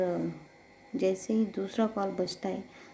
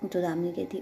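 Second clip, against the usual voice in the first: usual speaking voice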